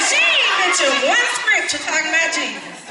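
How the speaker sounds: high, loud mocking critic with a laugh; mock the critics who wait to dog her out; church critic. Imitating ministry critics, high and loud, laughing. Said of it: "They like".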